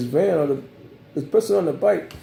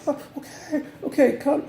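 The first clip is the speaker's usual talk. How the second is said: mock pleading; 12 semitones higher than usual. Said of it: "I was like".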